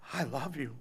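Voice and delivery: soft, low, tender voice of Christ; tender whisper of love. Acted. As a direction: say it gently to each listener. Christ's quiet words of love.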